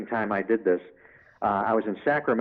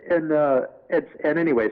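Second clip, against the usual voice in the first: usual speaking voice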